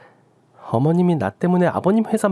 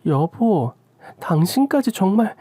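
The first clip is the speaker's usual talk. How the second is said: ordinary talk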